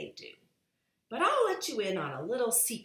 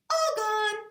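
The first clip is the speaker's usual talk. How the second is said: put-on much higher, louder baby voice; 7 dB louder than usual. A narrator's reading. Baby Blair announcing the empty bowl, high and babyish. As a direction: chirp it like a toddler. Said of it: "said Baby Blair".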